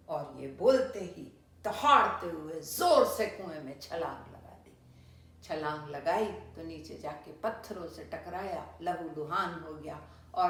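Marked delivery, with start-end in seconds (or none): none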